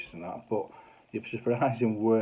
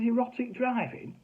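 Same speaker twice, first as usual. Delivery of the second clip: incredulous. Retold or imitated. Incredulous repeat.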